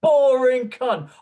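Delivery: loud, high-pitched scornful tirade; exasperated rant. Real, not acted. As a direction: spit contempt, hammering the swear words. Ranting at footballer's choice of Woods.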